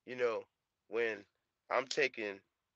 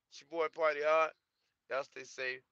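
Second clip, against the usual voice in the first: ordinary delivery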